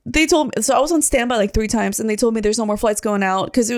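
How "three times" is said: usual speaking voice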